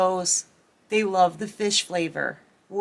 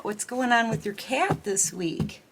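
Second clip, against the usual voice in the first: usual speaking voice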